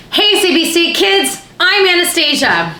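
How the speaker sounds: high, slow, bubbly presenter greeting; kids host voice. Shown as a demonstration. Auditioning for kids TV, doing the host voice. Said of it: "my audition is for C B C Kids Host".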